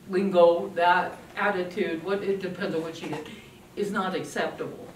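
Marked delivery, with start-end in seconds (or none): none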